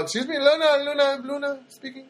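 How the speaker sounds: high mock accent; imitated thick-accented phone voice; Luna, thick accent. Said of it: "very thick accent".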